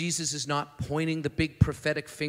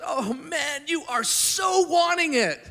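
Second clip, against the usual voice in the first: much higher and louder, pleading affection; moved, fervent; 11 semitones higher, 6 dB louder, slower than usual. Retold or imitated. Jesus longing over the young man, high and fervent. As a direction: ache with longing for him. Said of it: "And Jesus looks and goes".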